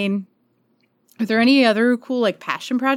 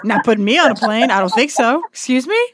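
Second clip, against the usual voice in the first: mock dog voice; 4 semitones higher, 5 dB louder than usual. Voicing the dog refusing.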